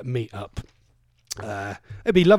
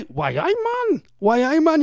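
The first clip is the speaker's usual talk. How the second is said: high-pitched, badly faked Geordie; attempted Geordie accent; 13 semitones higher than usual. Host warming up for Alan's accent, attempted Geordie accent. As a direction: overdo a poor Geordie impression. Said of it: "I can't do accents".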